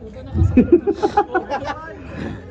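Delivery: long chuckle into the mic; real amused laughter. Real, not acted. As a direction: laugh along at the play. Commentator laughs during the game.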